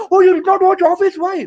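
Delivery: high, loud incredulous fan; Office fan. Retold or imitated. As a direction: play a shocked superfan.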